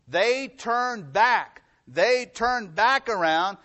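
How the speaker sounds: slow it right down and land on back; preacher dwelling on each word; slow emphatic. Real, not acted. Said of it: "That's the key here".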